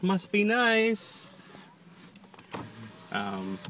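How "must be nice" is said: high, slow, drawn-out jealous whine; sarcastic whine. Real, not acted. Repeated, stretched for effect.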